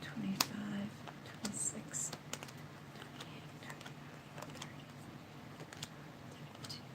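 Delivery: barely voiced, whispered counting to herself; counting under breath. Real, not acted. Psychic counting cards to herself, in a near whisper. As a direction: murmur the numbers almost silently as you count.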